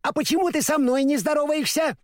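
high, indignant little dog; sharp offended yap of a small dog; indignant exclaim. A narrator's reading. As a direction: demand it, offended. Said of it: "с негодованием воскликнул".

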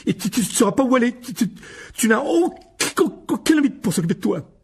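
put-on very high, loud panicked stuttering boy; shrill whimpering child in terror; terrified stammer. A narrator's reading. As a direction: stammer in sheer panic, near tears. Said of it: "déformé par la terreur".

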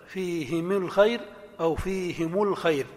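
فيهم الخير first as fihimi lkhayr, then as fihimu lkhayr; فيهمِ الخير وفيهمُ الخير; two allowed vowels. Shown as a demonstration. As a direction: kasra take, then damma take. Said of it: "يجوز الضم ويجوز الكسر".

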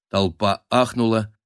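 ordinary delivery

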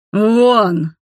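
acted angry bellow; shouted order. A narrator's reading. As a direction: yell it to throw them all out. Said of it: "заорал я".

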